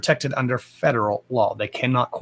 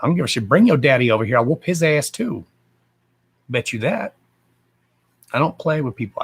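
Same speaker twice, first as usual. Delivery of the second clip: usual speaking voice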